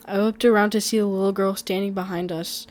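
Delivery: ordinary delivery, nothing marked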